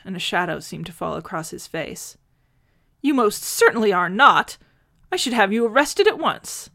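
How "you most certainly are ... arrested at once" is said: loud, high indignant outburst; an old man scandalised and threatening arrest; affronted bluster, louder and higher; outraged. Acted.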